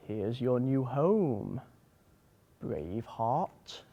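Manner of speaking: slow, quiet presentation of a new home; new owner's welcome. Acted. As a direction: draw it out gently, lifting at the end.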